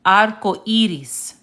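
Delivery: "Arco iris" slowly, each vowel distinct; careful, slow Spanish "Arco iris"; clear Spanish vowels. Shown as a demonstration. Teacher goes over the example again, slow clear "Arco iris". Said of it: "as I'm saying the word".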